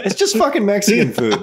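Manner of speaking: exasperated. Real, not acted. Exasperated outburst.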